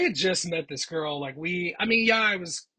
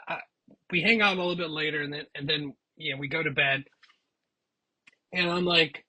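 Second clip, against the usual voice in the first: usual speaking voice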